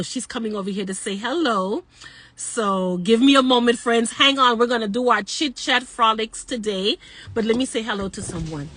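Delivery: ordinary delivery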